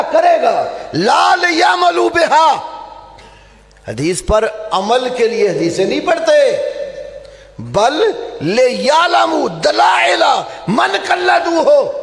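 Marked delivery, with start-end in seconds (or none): 0.9-2.5 shouted Arabic phrase. Real, not acted. Preacher hammering his point to the congregation, shouting. 7.6-12.0 declaimed Arabic. Real, not acted. Preacher declaiming Arabic quote.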